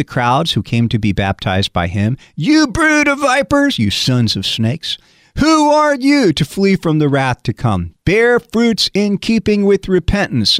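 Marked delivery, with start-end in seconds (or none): 2.3-10.6 a hint of a fiery preacher, slowed and higher; stern prophet. John the Baptist scolding the crowd, mildly voiced rebuke. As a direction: rebuke them like a prophet, lightly.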